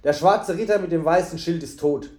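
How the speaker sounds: plain reading voice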